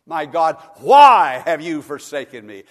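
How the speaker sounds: very loud cry of abandonment; Jesus's cry from the cross, thundered by a preacher; anguished cry. Retold or imitated. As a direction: cry out to heaven in agony. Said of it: "Even Jesus asked that question on the cross".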